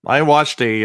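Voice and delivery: ordinary talk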